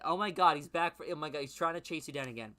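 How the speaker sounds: loud, high oh my God; shocked that he is back; alarmed outburst. Real, not acted. Alarmed reaction to plot.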